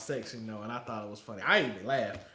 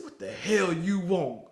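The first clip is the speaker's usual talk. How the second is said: slow, louder drill instructor; mock drill instructor snap; 6 semitones higher, 7 dB louder, slower than usual. Drill instructor answers McMillan, slow mock bark. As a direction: bark it slowly at the recruit. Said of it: "He said".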